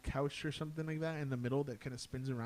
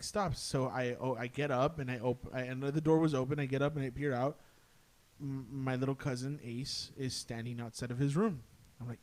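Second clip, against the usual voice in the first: ordinary talk, nothing marked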